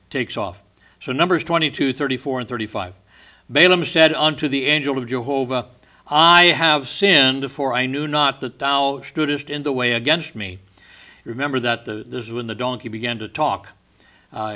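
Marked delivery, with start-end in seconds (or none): 3.5-10.6 scripture reading. Acted. Preacher reading Balaam's confession to the congregation, solemn. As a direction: declaim the confession from the pulpit. Reads Numbers aloud in sermon.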